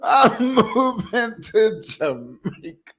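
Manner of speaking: slow high teasing drawl on "I'm moving to Jamaica"; drawn-out mocking sing-song. Repeats the line twice differently.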